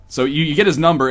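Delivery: ordinary delivery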